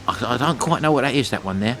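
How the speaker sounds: usual speaking voice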